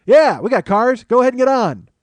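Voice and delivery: loud, high holler; driver calling out. Retold or imitated. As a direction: call out to someone waiting in the street. Driver calls out to stranded man.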